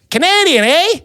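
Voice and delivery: high, hammed-up Canadian accent; put-on Canadian accent. Diner passing himself off to a tourist, fake Canadian accent. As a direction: lay a Canadian accent on thick. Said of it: "I did my best maple syrup".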